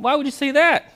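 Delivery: offended. Acted. Friend offended at insult.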